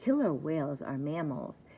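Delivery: ordinary delivery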